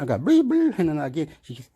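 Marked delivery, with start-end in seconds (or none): 0.2-0.8 high, loud squeaky bleeps; imitated bleeps. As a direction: bleep like an alarm in a high pitch. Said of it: "I say things like".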